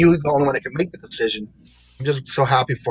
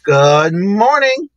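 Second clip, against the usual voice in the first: drawn-out greeting; 7 semitones higher, 6 dB louder, much slower than usual. Real, not acted. Stretched greeting to guest.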